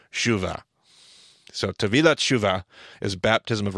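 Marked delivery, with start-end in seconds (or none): none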